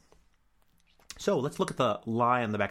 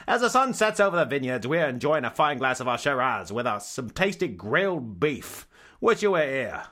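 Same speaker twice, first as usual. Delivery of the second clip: louder hammed-up Australian tourist-ad voice; mock Aussie accent; 3 semitones higher, 4 dB louder than usual. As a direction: sell the sunset like an Australian ad. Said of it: "Very Aussie".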